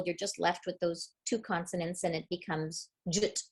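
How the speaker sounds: ordinary talk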